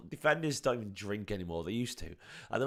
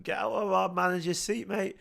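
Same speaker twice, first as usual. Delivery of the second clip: mock-indignant, pitched up high; mock order to a celebrity; 10 semitones higher than usual. Fan telling an actor to leave the manager's seat, mock outrage. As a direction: play the outraged fan, half joking. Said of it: "and I went".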